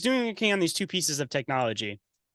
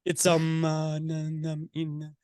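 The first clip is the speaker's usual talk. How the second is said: halting stilted robotic delivery, pitched up; awkward robot voice; 3 semitones higher, slower than usual. Retold or imitated. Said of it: "You gotta do it in the awkward voice because he definitely talks like a robot".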